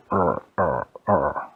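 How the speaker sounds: low, short grunting noises; imitated low grunts. Storyteller voices what the characters heard, low grunts. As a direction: grunt it out low, in separate bursts.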